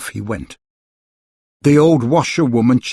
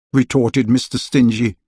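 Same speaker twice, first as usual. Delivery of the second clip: ordinary delivery, nothing marked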